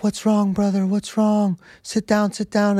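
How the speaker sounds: a worried monk urging him to sit; caring monk. Retold or imitated. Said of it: "And he's like".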